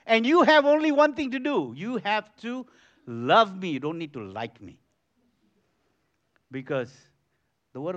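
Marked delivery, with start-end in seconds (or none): 0.1-3.7 loud commanding proclamation, then easing; emphatic shout. Real, not acted. Preacher loudly tells the congregation to love him. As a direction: declare it loudly to the crowd.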